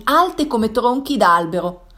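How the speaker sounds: ordinary delivery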